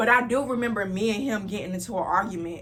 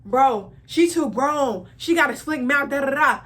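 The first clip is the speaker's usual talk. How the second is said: mocking brother; 5 semitones higher, 5 dB louder than usual. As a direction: mimic him tattling to mom in a put-on voice. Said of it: "My brother was like".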